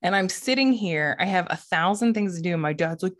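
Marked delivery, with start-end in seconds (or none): none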